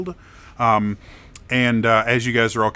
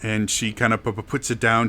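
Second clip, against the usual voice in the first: ordinary talk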